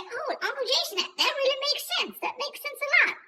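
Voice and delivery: lightly voiced child won over; imitating a kid. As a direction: play the convinced nephew, wide-eyed. Nephew voiced in story.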